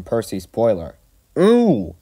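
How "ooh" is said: high, loud muffled "ooh"; startled, smothered yelp; muffled exclamation. A narrator's reading. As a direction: cry out as if something covers the mouth. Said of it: "he exclaimed in a muffled voice".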